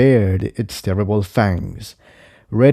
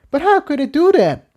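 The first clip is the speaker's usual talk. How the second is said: much higher voice, bewildered; Tiptoe asking. Acted. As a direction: ask in disbelief. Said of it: "Tiptoe inquired".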